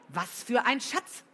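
loud, sharp demand. A narrator's reading. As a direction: demand it loudly. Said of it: "Flo's Stimme war laut geworden".

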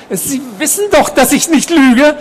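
very high, strained insistence getting loud; agitated. A narrator's reading. Patient insisting to nurse he is no liar, highly agitated. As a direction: protest frantically at a very high pitch. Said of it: "Seine Stimme wurde aufgeregter".

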